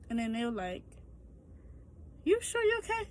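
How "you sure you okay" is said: much higher put-on nurse voice; nurse's voice. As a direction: ask it in a high, caring nurse voice. Nurse asks if she's okay.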